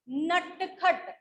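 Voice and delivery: नटखट drawn out very slowly; slow clear word. Shown as a demonstration. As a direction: stretch नटखट for dictation. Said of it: "अगर मैं बोलूं नटखट". (नटखट धीरे धीरे साफ़ बोलकर)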